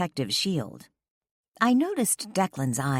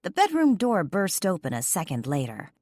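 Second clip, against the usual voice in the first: usual speaking voice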